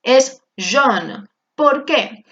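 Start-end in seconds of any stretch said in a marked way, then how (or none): 0.6-1.2 jaune pronounced with a closed O sound. Shown as a demonstration. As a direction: say jaune with the vowel of O. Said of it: "se va a pronunciar como una O". (jaune pronunciado con O)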